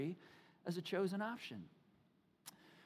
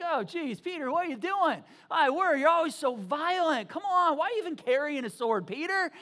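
loud, high comic reproach; exasperated scolding; 5 semitones higher, 7 dB louder than usual. Acted. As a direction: tell off a friend in hammed-up disbelief. Acted scolding of violent Peter.

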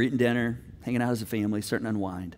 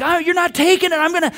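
very high, loud defiance; fighting back; 11 semitones higher, 10 dB louder than usual. Acted. Daughter fighting dad over phone, heated protest. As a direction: protest in a heated mock-teen voice. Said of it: "she's fighting me".

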